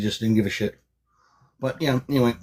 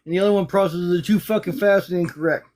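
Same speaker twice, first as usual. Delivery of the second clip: heated rant; 7 semitones higher than usual. Real, not acted.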